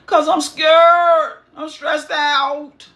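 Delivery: slow, high, pleading fear; scared Saul. Acted. Saul tells Samuel his fear, high and slow. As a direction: drag it out like a frightened king.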